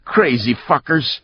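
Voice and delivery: high-pitched angry shout; old man's shout. A narrator's reading. Old man cursing departing brawlers, shouting. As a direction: shout the insult after them. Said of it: "the old man shouted".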